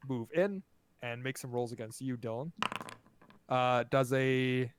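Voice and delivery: usual speaking voice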